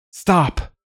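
sudden high, loud cry to halt; shout. Acted. Narrator stopping a gunfight, urgent shout. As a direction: yell stop at the rifles. Narrator halts armed standoff.

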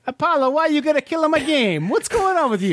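exaggerated Italian accent, pitched high; hammy Italian accent, high voice. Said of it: "I was doing the Italian accent".

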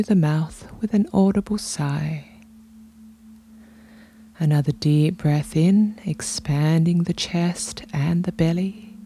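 unhurried meditation voice with long pauses; guided meditation. Real, not acted. Podcast host guiding listeners into their bodies, calm meditation delivery. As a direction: guide them gently through breath and body, leaving space between phrases.

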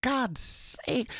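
high-pitched, desperate outburst; distraught, stretching out the last words; frantic plea. A narrator's reading. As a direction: plead frantically with people who won't look. Frantic man pleads with bystanders.

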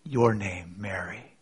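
soft, low, intimate naming; barely voiced, as if only for her ear; near whisper. A narrator's reading. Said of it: "this supposed gardener whispers a single word".

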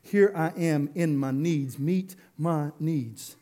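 slow, low, softened plea; prayerful groaning. Acted. Needy believer groaning out to God, prayerful plea. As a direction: draw it out low, an acted groan of need. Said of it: "or prayerful groaning out to God".

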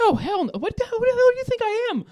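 high, laughing mock outrage; laughing indignant. Real, not acted. Asked about handcuffs, answers with high laughing mock outrage. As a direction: play offended while cracking up. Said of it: "You've never been in handcuffs?".

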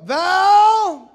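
high, very loud drawn-out holler; calling out. Retold or imitated. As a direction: holler for the next sister. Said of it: "I call Val over".

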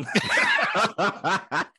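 breaks into laughter; laughing too hard to talk. Real, not acted. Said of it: "laughing at the story".

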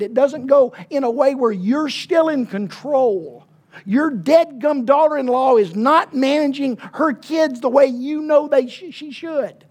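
loud, high, driving sermon delivery; fiery preaching. Acted. Preacher stirring the congregation, preaching at full force.

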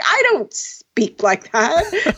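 slow, drawn-out pretend indignation; hammed-up slow denial; mock protest. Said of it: "I'd be like".

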